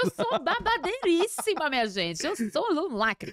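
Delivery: high, swooping, drawn-out brag; playful diva boast. Real, not acted. Guest boasting to her podcast host about surviving, playful diva boast. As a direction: crow it theatrically, stretching the big word.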